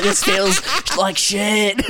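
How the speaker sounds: high, slow mock complaint; mock pained drawl. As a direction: drag it out like stepping on glass. Mimicking friend on broken glass.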